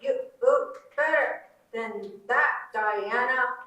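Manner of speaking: slow, loud scolding from a mother; stern motherly rebuke, drawn out. Acted. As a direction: scold her like a disappointed mom. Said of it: "I know that scolding tone anywhere".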